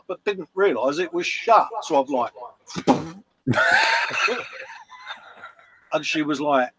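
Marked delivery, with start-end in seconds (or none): 3.5-5.1 a laugh that breaks into a high shout; shrieking laugh. Real, not acted. Laughing at crashing into the door.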